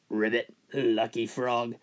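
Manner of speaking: low, soft croaking frog. Acted. Golfer hears a frog on the green, low soft frog voice. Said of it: "Frog says".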